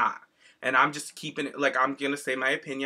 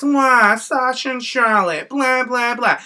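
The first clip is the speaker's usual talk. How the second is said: mocking whine; 9 semitones higher, 6 dB louder, slower than usual. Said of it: "let me guess".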